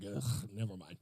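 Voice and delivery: low, soft groan of giving up; dismissive disgust. Retold or imitated. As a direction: drop your voice and wave it away with an "ugh". Recalls giving up on a book.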